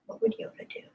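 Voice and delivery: breathy high whisper; whispered aside. Pretend. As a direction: whisper it to yourself. Musing on Yoda.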